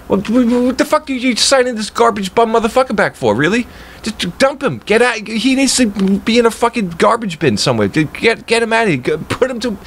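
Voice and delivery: ranting, worked up over a pitcher he hates; angry rant. Real, not acted. Fan ranting to viewers about a re-signed pitcher, real heated anger. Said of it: "Oh, man, I'm getting angry".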